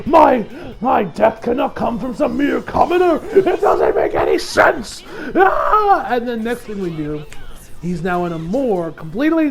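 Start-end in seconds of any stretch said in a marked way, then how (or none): 0.0-6.0 high, loud indignant shouting; outraged shouting. Acted. Diavolo furious that a mere commoner cut him, shouting. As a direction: rage against a commoner killing you.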